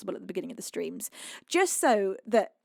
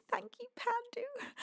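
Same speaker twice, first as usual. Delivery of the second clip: soft high thanks; 13 semitones higher, 9 dB quieter, slower than usual. Real, not acted. Tender thanks to viewer.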